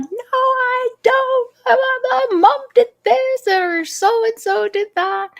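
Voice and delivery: put-on high, fast child voice brushing it off; upset child. Parent voicing a child telling what happened. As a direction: play a kid rattling off complaints.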